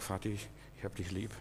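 viel leiser, sanft zum Vater gesprochen; zärtlich leise. Retold or imitated. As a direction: ganz leise und zärtlich hinhauchen. Son tells his father he loves him. (Sohn zum Vater, ganz leise und zärtlich)

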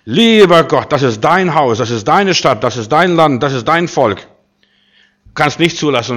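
lautes, flehendes Gebet, dein betont; inbrünstiges Gebet. Acted. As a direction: mit erhobener Stimme zu Gott flehen. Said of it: "erhebt seine Stimme zum Herrn". (Hiskia betet im Tempel, laut und inbrünstig)

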